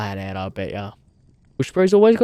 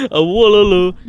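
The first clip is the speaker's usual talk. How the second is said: louder, higher, through laughter; amused request readout; 4 semitones higher, 6 dB louder than usual. Real, not acted. Streamer laughing at a viewer's request for a wallaloo, amused. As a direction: read it out cracking up.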